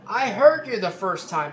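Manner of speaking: high, loud snapped retort; mock angry retort. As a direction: bite back at the lady, loud and high. Guy's angry retort quoted.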